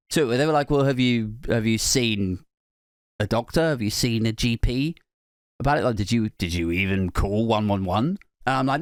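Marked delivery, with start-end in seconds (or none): 0.7-8.2 low, understated scepticism; dismissive, pushbacky tone. Retold or imitated. Admin lady doubting worried couple, mildly dismissive. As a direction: imitate doubtful questioning in a low voice. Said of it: "sort of dismissive, pushbacky tone".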